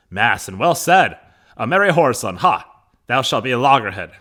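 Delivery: loud stage exclamation; hearty Capulet. Acted. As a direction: boom the master's retort to a servant. Acting Capulet's hearty reply.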